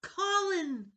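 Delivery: high, loud shout of a name; desperate shout. Acted. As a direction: cry out in the dark. Calling to drowning friend.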